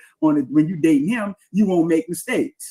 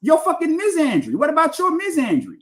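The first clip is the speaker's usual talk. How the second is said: much higher mocking voice of an accuser; mocking impression; 10 semitones higher than usual. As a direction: parody the accuser, high and scornful. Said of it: "you see that?".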